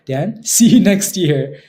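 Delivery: laughing through the goodbye, slow. Real, not acted. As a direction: chuckle out the words. Laughing farewell to a chatter.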